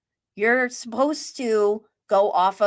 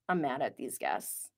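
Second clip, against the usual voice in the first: ordinary talk, nothing marked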